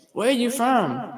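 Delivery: drawn-out high question; high, slow question. Retold or imitated.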